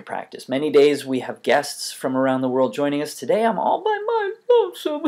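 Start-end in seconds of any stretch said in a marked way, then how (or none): 3.1-5.0 high sobbing voice, pretend tears; fake crying. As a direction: sob it out, then turn to laughter. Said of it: "I was pretending to cry".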